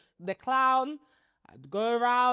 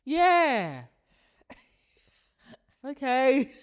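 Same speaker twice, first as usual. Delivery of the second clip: higher, put-on "yeah, okay"; forced agreement; 5 semitones higher, 3 dB louder, much slower than usual. Humouring someone without returning the warmth, forced. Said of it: "you can just tell I'm forced".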